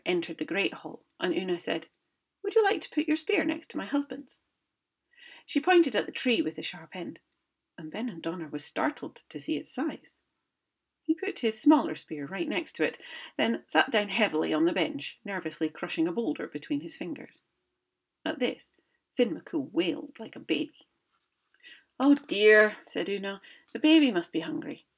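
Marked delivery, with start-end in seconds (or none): 2.4-4.4 high, sweetly scheming wife; lightly voiced crafty wife offering; Una's voice. Una voiced to the giant. 22.0-22.8 high, loud mock-worried wife; hammed-up motherly fuss; Una's voice. Una voiced in story.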